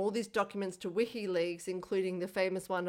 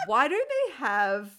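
mock indignant; 3 semitones higher, 6 dB louder than usual. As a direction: complain like it's a real injustice. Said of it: "me to be like".

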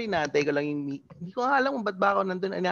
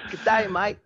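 a slightly higher, brighter Australian accent; 4 semitones higher, 4 dB louder, slower than usual. Shown as a demonstration. Guest repeating the Aussie greeting, lightly Australian. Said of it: "Mga ganun, mga sagwa".

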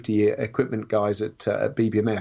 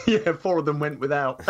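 laughing; 4 semitones higher than usual. Real, not acted. Joke about missing bombs.

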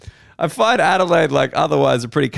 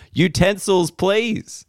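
mock customer; 7 semitones higher, much slower than usual. Mocking a customer's delivery note.

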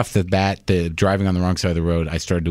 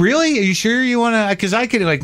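high fake-concerned surprise; mock polite surprise; 13 semitones higher than usual. Comic to club owner, high mock surprise. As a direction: pretend to be sorry to go. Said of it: "And I'm like".

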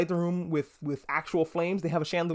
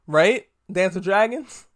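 louder, rising Right? pressed on the viewers; insistent loud right; 3 semitones higher, 5 dB louder, slower than usual. Real, not acted. Host pressing viewers to agree about Dance of Dragons, loudly. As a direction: push the question at the audience.

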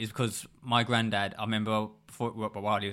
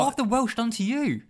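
mock outrage; 12 semitones higher than usual. Real, not acted.

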